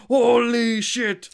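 huge drawn-out high, loud exclamation; Joseph Joestar. Retold or imitated. Fan imitating an anime hero's English line, hammy high shout. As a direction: bellow it slowly like an over-the-top anime hero. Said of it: "Jonathan, uh, Joseph Joestar being like".

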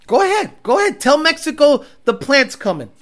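high, mocking dare, egging them on. Real, not acted. Daring Ford to tell Mexico, high mocking dare.

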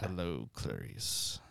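low, slow, quiet menace; Hannibal Lecter. Acted. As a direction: purr it like Lecter. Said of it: "Silence of the Lambs".

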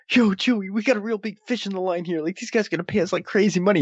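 high, eager excited voice; excited Han. Retold or imitated. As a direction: pitch it up, pumped about money. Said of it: "when Han's all excited".

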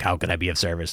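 low, soft otherworldly servant voice; a low, hushed servant offering help; summoned shade. Retold or imitated. Summoned shade answering the player, low and hushed. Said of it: "And she's like".

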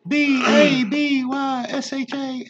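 high, slow spelled-out letters; cheerleader spelling. Pretend.

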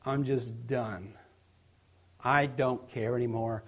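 low, slow, quiet resignation; burned out. Acted. Preacher voicing someone at burnout, drained and resigned. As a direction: give up softly, letting the pitch sink. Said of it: "burnout is when you say".

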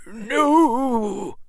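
put-on high, quavering elderly voice; quavering old man. A narrator's reading. Old man answering the boy, quavering. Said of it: "quavered the old man".

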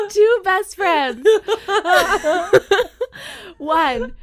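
slow and laughing. Real, not acted. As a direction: grin through the words.

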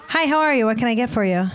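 high, bright service voice; cheery bartender greeting. Retold or imitated. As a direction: greet the customer like a chipper bartender. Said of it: "my impression of".

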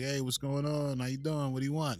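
lightly put-on Brooklyn accent. Imagined Brooklyn grocer greeting a customer, Brooklyn accent. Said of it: "any grocery store in Brooklyn".

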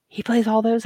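high, impressed question; imitating the guest's high amazed question; amazed young man. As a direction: sound wowed by it.

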